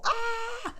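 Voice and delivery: high-pitched "aah" of release; high acted "ah"; mock cry. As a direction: let out a high mock cry like animals set loose. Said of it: "we all just kinda go".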